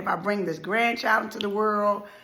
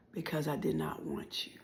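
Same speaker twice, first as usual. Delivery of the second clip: whispered last words; 5 semitones lower, 14 dB quieter than usual. Retold or imitated. The dying mother's final words to her daughter, whispered. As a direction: let it fall as a whisper.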